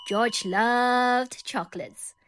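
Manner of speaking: ordinary delivery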